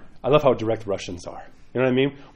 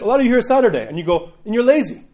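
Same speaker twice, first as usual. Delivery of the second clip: higher, louder mock scolding; scolding priest; 8 semitones higher, 5 dB louder than usual. Priest retells the Russian priest scolding the lazy, loud. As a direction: call them lazy with a raised voice. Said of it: "And he says".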